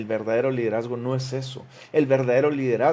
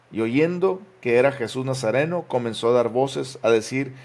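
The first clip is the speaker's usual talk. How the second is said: raised, louder solemn Bible reading; scripture reading; 2 semitones higher, 5 dB louder than usual. Acted. Pastor reads Mark aloud to church, raised proclaiming voice. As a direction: proclaim the verses to the congregation.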